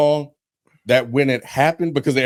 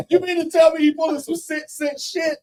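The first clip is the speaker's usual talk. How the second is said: laughing rant. Real, not acted. Ranting at movie twist.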